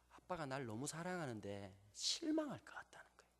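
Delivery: put-on hushed, breathy child's whisper; a soft whispered secret; whispered confession. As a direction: whisper it like a child afraid to disappoint. Daughter's tearful confession retold.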